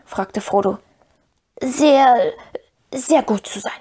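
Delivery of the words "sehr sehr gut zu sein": whispery creature voice, pitched up; promising Gollum. A narrator's reading. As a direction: promise in a hissing, ingratiating whisper. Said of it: "versprach Gollum".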